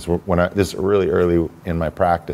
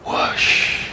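whoosh. Retold or imitated. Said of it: "I just felt this".

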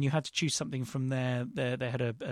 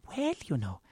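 little voice; 13 semitones higher, 3 dB louder, slower than usual. Retold or imitated. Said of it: "a little voice from the panel".

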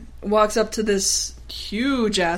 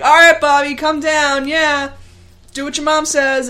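put-on raised, loud call to a kid; 6 semitones higher, 5 dB louder than usual. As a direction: call up to the boy in the tree. Martin voiced calling to boy.

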